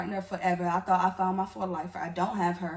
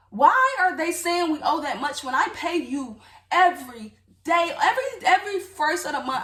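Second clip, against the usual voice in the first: loud, high, insistent questioning; heated, acted-out protest; indignant confrontation; 6 semitones higher, 6 dB louder than usual. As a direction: demand an answer, hammering each phrase. Acted confrontation with girlfriend over rent.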